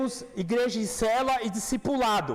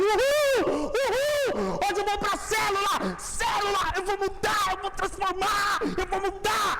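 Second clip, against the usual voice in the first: mock excited believer; 6 semitones higher, slower than usual. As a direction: cheer like a fired-up churchgoer.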